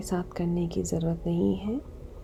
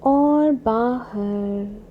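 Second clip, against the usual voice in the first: very slow, sustained words, starting louder; drawn-out exhale cue; 3 semitones higher, 5 dB louder, much slower than usual. Acted. Guide leading a long breath out, drawn-out exhale cue. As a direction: stretch the words across a full exhale.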